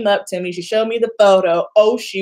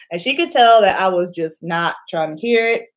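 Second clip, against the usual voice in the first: ordinary talk, nothing marked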